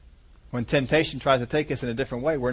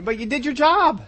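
much higher, scoffing brush-off; dismissive retort; 7 semitones higher than usual. Acted. Boss brushing off a worker's work, scoffing and much higher. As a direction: wave away their effort like it's nothing. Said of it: "we can sometimes get focused on".

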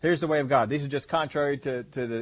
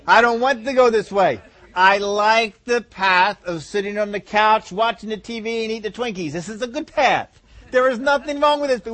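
louder, higher whining protest of a lazy body; the couch-loving body throwing a fit; mock outraged body refusing to leave the couch; rebellious body; 5 semitones higher, 6 dB louder than usual. Body rebelling against exercise.

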